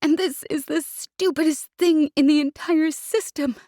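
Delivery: tearful outburst; sobbing. Acted. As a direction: sob out the words. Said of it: "she sobbed".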